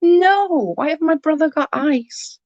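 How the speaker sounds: high-pitched mock dismay; gutted. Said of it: "I was gutted".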